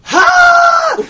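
very high, loud mock burst of laughter; imitating sudden laugh. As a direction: let out one shrieked "ha", imitating a friend. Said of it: "Just started laughing at it".

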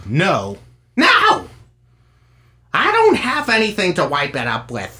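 mock wife's yell, leaping high and loud on the second No; retold yell. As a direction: imitate her yelling, building from a normal No to a high cry. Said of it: "I hear her yell".

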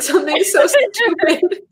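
real giggle bubbling up into speech; breaking into laughter. Real, not acted. Mocking a film star's pick to a friend, breaking into laughter. Cracking up over a silly pick.

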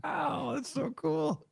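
very high, soft little voice; hushed awe. Real, not acted. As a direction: marvel softly in a tiny high voice. High soft reaction to full show floor.

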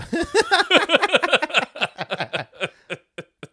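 long high burst of laughter; loud laughing fit. Real, not acted. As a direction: crack up loudly at the pun. Big laugh after pun.

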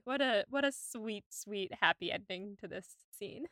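ordinary talk, nothing marked

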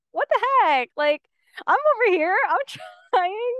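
high, loud retelling of annoyance; annoyed outburst. Retold or imitated. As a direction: protest loudly in exasperation, half laughing. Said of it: "kind of annoyed".

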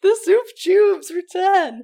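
slow, loud and high, like a movie trailer; mock announcement. Cohost hyping the soup cat saga, slow trailer voice. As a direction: announce it like a grand sequel.

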